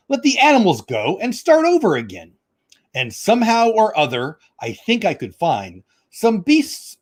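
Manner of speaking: sing-song Seuss reading, drawn out in places; storybook reading. Acted. Reading Dr. Seuss aloud to viewers, bouncy storybook voice. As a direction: read it like a picture book for kids.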